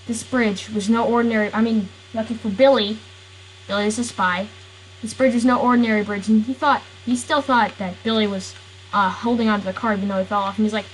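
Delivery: usual speaking voice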